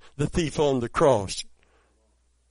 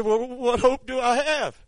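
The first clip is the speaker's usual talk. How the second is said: pleading; 11 semitones higher, 4 dB louder than usual. Retold or imitated. Dying man pleads with pastor.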